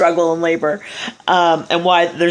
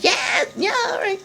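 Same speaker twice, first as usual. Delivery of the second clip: put-on casual kid Adam; 4 dB quieter than usual. Adam tastes apple, voiced.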